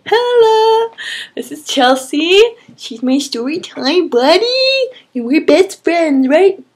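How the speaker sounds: high, sing-song pet voice. Real, not acted. She introduces her cat Chelsea to viewers in cooing baby talk. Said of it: "talking to her cat in baby voice".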